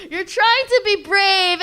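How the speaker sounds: high, slow mocking echo; teasing echo. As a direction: drag it out to tease.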